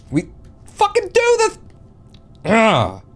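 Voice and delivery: very high, loud angry yell; sudden high-pitched rage; frustrated outburst. Real, not acted. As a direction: explode at the game mid-sentence. Game frustration boils over.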